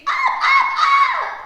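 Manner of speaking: piercing, very high frightened shrieks; scream. A narrator's reading. Jube is chased by the puppy, screaming. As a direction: shriek in panic. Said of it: "she screams".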